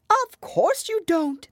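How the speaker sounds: loud, high, drawn-out scorn; contemptuous. A narrator's reading. Hatter scorning Alice for not understanding, contemptuous. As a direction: dismiss her grandly, stretching the words. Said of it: "tossing his head contemptuously".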